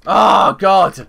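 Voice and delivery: loud, high frustrated yell at the screen; frustrated shout. Real, not acted. Caught stealing again, he shouts at the game in exasperation. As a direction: blurt it out loud in real exasperation.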